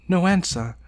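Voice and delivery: usual speaking voice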